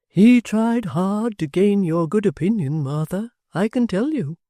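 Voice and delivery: a lightly voiced fond old father, wistful; kindly locksmith. A narrator's reading.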